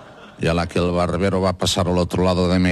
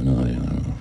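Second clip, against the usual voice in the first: farmer's deadpan; 5 semitones lower, 4 dB quieter than usual. Retold or imitated. Farmer answers tourist.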